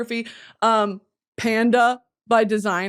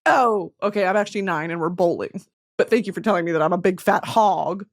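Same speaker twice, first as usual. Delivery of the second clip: ordinary delivery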